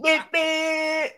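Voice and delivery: high, repeated car-horn honks; imitated horn beeps. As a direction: honk like an impatient driver. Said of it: "boy, they beep".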